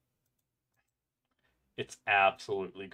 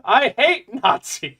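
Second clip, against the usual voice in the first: loud; 13 semitones higher, 14 dB louder, slower than usual. Acted. Gleeful outburst at meme.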